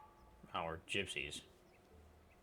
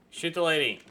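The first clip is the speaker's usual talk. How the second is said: higher, loud urgent call; excited shout; 9 semitones higher, 14 dB louder than usual. Real, not acted. Gamer urges the shot during the fight, shouting. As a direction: yell it at the screen.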